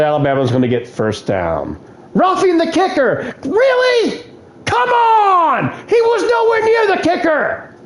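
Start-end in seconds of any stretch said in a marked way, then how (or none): 2.1-7.7 high, yelling disbelief at the ref; outraged protest. Real, not acted. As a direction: shout at the bad call. Gamer protests penalty call.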